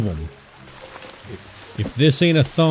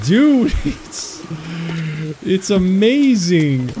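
excited; 11 semitones higher, much slower than usual. Real, not acted. Thrilled at his build working.

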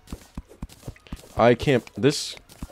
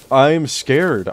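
ordinary talk, nothing marked

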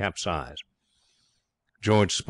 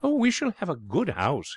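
high, airy Mifflin voice; breezy, higher-pitched character voice; blithe schemer; 10 semitones higher than usual. A narrator's reading. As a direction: cheerfully brush off the complaint.

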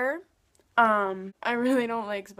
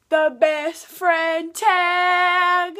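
drawn-out announcement; 6 semitones higher, 7 dB louder, much slower than usual. Acted. Announcing the video.

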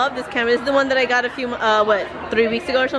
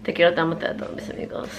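very soft sign-off; hushed; 6 semitones lower, 7 dB quieter, faster than usual. Real, not acted. Speaker bidding viewers goodnight near sleepers, hushed. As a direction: say goodnight under your breath.

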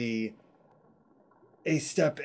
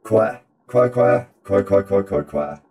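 loud volley of duck quacks; rapid-fire loud quacking; quack mode; 10 dB louder than usual. Pretend. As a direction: quack loudly in quick bursts. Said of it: "que mode now".

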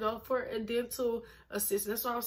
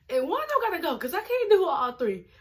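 exasperated; 7 semitones higher, 7 dB louder than usual. Real, not acted. Raised, exasperated realization.

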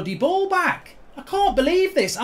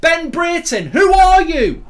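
loud, higher incredulous yell; angry shout; 3 semitones higher, 8 dB louder than usual. Real, not acted. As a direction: bellow it at the player who's beating you. Raging at opposing player.

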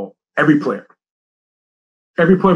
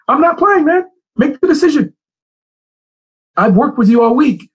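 high, raised, fed-up reply; exasperated retort; 11 semitones higher than usual. Retold or imitated. Coach telling a player on the sideline to decide, raised and exasperated. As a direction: snap it back at the player, much higher.

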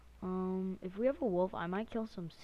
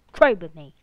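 shouted demand, punched hard; loud demand; 19 dB louder than usual. Pretend. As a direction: shout the order at him. Commanding a villager to trade.

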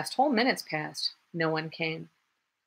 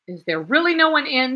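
loud, high, cheerful call; bright hail to the first visitor; cheerful shout; 7 semitones higher, 7 dB louder than usual. A narrator's reading. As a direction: shout it through the door with good cheer. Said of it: "shouted the new man, loudly and cheerfully".

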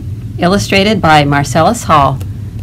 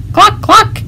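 high-pitched chicken cluck; clucking duck; 14 semitones higher than usual. Acted. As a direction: cluck like a silly hen.

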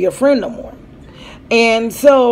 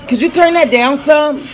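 raised, higher voice asking them to turn it down; calling out; 6 semitones higher, 3 dB louder than usual. Real, not acted. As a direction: call across the room to turn it down. Asking kids to lower noise.

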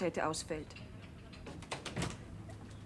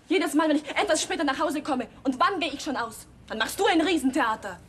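louder, heated grievance; loud complaint; 5 semitones higher, 6 dB louder than usual. Acted. Daughter loudly reproaching her father for his scenes. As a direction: throw the old grievance at him.

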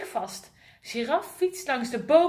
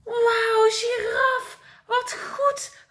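much higher, louder cry of praise; excited call; 9 semitones higher, 4 dB louder, much slower than usual. Acted. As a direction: cheer her on, amazed. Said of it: "roept zebra".

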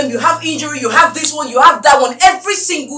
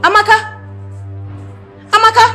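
higher-pitched call to rouse someone; 7 semitones higher, much faster than usual. Acted. Employer calling her unresponsive maid, calling out. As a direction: call the name sharply upward.

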